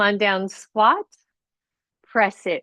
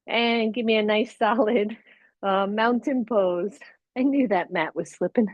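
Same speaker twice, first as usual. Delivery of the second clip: usual speaking voice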